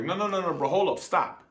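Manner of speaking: mock urgent shout to halt a man; calling after him. Chasing the clerk down.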